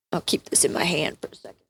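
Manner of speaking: fast, soft unvoiced aside; quick whisper. Real, not acted. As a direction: rush it under your breath.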